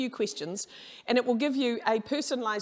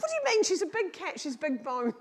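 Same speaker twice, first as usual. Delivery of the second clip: mock indignant; 7 semitones higher than usual. Owner defending her fat cat to the young vet, mock indignant. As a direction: protest like an offended cat owner.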